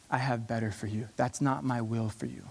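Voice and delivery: God's still small voice, tender and low. Acted. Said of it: "his still small voice".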